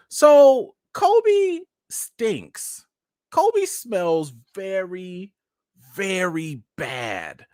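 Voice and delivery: very slow, spaced-out words, starting high and loud; drawn-out announcement. Real, not acted. Owner giving update on his dog, drawn-out announcement. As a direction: drag out each word for comic weight.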